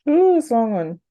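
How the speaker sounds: normal talking voice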